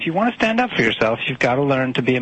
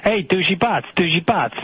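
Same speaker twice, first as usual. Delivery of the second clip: mock Italian accent; 4 semitones higher than usual. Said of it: "he keeps saying it like".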